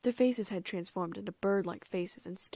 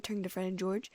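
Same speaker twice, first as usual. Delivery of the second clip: ordinary delivery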